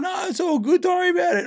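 very high, dopey, cheerful voice; dazed cyclist. Retold or imitated. Concussed cyclist brushing off the crash to the driver, very high and dopey. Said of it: "delusional as fuck".

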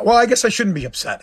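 usual speaking voice

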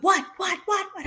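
high, loud rapid what what; mock the husband's alarm; high loud mock panic; startled husband. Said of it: "my husband was like".

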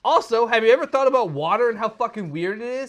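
high, loud, rushed excited rambling; racing thoughts. Retold or imitated. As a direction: babble like your brain won't stop. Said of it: "I'm like, I can't stop thinking".

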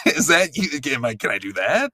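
normal talking voice

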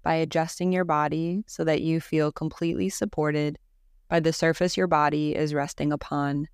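calm, even Yoga Nidra guidance with long pauses; guided meditation. Acted. Teacher guiding listeners through Yoga Nidra practice, calm guided-meditation voice. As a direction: lead them gently into rest, leaving space after each phrase.

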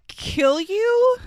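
ordinary delivery

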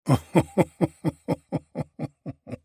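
high, booming laugh of a delighted giant king; king's laugh. A narrator's reading. Giant king laughing at Thor's failed lift, hearty laugh. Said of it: "laughed the king".

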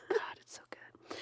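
hushed unvoiced whisper; whispered confession of love for the movie. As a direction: breathe it like a guilty secret.

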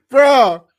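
loud laughing bro; loud outburst. Real, not acted. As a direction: burst out loud in disbelief.

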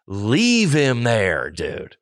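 slow and drawn out; slow emphatic. Real, not acted. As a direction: drag out each word. Slowed emphatic repeat.

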